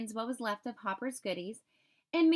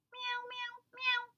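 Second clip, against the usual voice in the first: kitten meows, much higher and softer; 11 semitones higher, 4 dB quieter, slower than usual. A narrator's reading. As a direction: mew like little kittens, high and soft. Kittens heard meowing.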